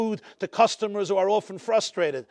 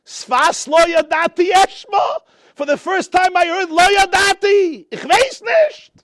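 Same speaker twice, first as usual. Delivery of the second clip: shouted, much higher, close to tears; impassioned cry; 8 semitones higher, 7 dB louder, slower than usual. Real, not acted. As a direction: cry it out at the peak of the sermon. Preacher's climax, crying out.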